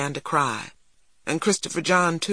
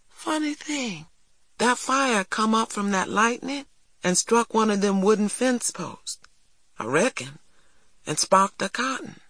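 put-on higher boyish storytelling voice; earnest neighbour boy; 6 semitones higher than usual. A narrator's reading. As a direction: explain yourself like an earnest boy. Voices neighbour boy's account.

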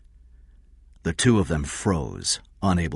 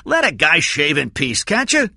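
irritated high, loud shout; 11 semitones higher, 6 dB louder than usual. A narrator's reading. Cy shaving, wants peace, shouting. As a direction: shout back from another room. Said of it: "Cy shouted from the bathroom".

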